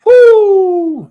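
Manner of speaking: high loud whoop; whoo shout. Real, not acted. Host whooping on the show, loud high whoo.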